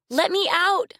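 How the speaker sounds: high, loud desperate scream; screaming girl. A narrator's reading. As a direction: shriek to be let out of the cage. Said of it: "she screamed".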